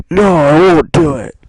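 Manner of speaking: loud, high firm no; defiant refusal. Retold or imitated. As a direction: slam the refusal down. Connor refusing.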